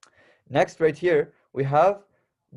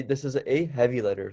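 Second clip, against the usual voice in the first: ordinary delivery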